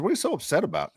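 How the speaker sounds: lightly voiced questioning wife; imitated wife. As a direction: lift the pitch to mimic her questioning. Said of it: "she goes".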